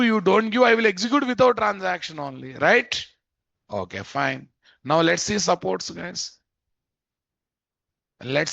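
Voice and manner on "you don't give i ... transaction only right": voicing the method. Voicing the Supports attribute.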